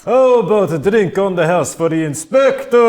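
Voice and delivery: high, loud boisterous barkeep; jolly innkeeper. Acted. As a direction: bellow it like a merry host. Innkeeper announces drinks.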